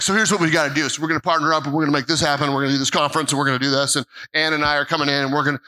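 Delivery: very fast, tumbling plans; rapid excited plans. Retold or imitated. Friend rushing through partnership plans after the service, very fast. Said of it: "He's like".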